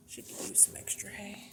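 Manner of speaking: whisper. Real, not acted. Talking softly near the rabbit.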